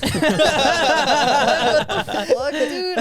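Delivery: slow, loud, high, laughing outcry; laughing disbelief. Real, not acted.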